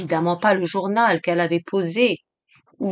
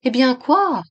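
higher-pitched, baffled question; stupefied; 6 semitones higher, 3 dB louder than usual. A narrator's reading. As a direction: ask it with blank incomprehension. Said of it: "stupéfaite, ne comprenant pas". (stupéfaite, sans comprendre)

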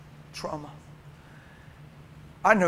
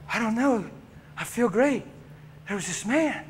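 higher-pitched bewildered survivor; raised, wondering voice; dazed survivor; 5 semitones higher than usual. Acted. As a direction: play someone just woken up, puzzled. Acts the revived man's account.

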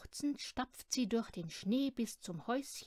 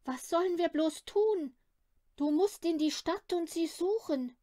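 anxious mother on phone; 6 semitones higher, 6 dB louder than usual. Acted. Mother phones father, child missing.

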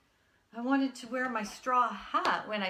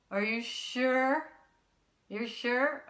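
ordinary talk

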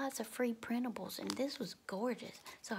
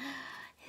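delighted gasp; 4 semitones higher, 5 dB quieter than usual. Real, not acted. Delighted by the tiny envelope.

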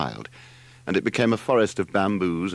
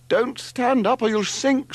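very high, loud, drawn-out screech; 5 dB louder than usual. A narrator's reading. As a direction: screech a panicked warning. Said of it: "screeched Margot".

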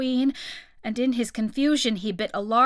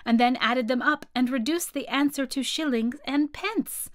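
usual speaking voice

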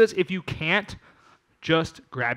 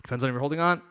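usual speaking voice

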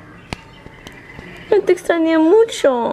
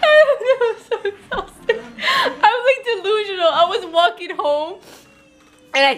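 high, exhausted laugh-cry; giddy sobbing laughter from sheer tiredness; 4 semitones higher, faster than usual. Real, not acted. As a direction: talk through tearful laughter. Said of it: "Crying or laughing?".

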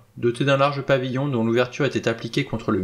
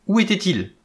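higher, urgent cried question; crying out; 8 semitones higher, 4 dB louder than usual. A narrator's reading. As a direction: call it out in alarm. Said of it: "s'écria Vera".

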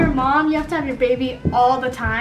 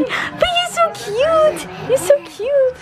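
gushing; 13 semitones higher, slower than usual. Real, not acted. Gushing over the baby.

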